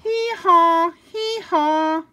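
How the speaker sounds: loud high double bray; donkey bray. Acted. Reader ends the book with loud brays. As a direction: bray out twice, big finish.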